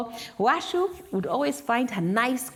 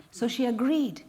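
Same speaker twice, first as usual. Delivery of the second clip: plain reading voice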